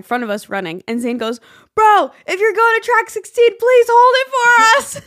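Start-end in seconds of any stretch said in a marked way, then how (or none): 1.7-5.0 calling out. Retold or imitated. Calling to a stranger running ahead.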